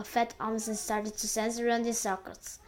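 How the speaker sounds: ordinary delivery, nothing marked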